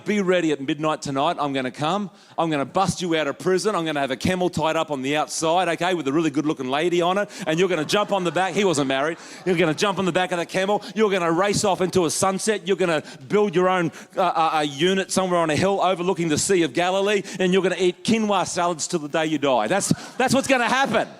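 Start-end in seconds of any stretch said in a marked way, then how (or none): 0.0-8.5 fast, low, rattled-off rescue plan; mock Hollywood promise. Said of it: "and Jesus saying". 9.5-19.7 fast, low, rattled-off rescue plan; mock Hollywood promise. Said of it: "and Jesus saying".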